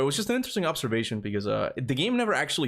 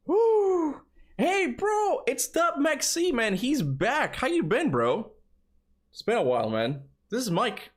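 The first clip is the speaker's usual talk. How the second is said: excited greeting; 13 semitones higher, slower than usual. Real, not acted. Greeting a returning viewer.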